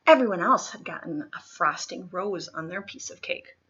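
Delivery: ordinary delivery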